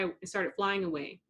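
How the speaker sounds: plain reading voice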